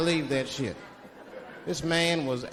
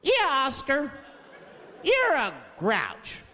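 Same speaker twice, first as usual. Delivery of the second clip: high, slow teasing chant at the grouch; mock him like a bratty child; taunting kid voice; 9 semitones higher, slower than usual. Kids taunting Oscar.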